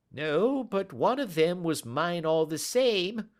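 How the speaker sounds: high pleading whine; whining. Acted. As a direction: whimper it like a beaten dog. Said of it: "whined the little man".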